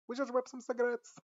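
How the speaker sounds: a clumsy attempt at a British pop-star accent; British accent try. Trying the singer's British accent on the character's line. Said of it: "do, like, [name] accent".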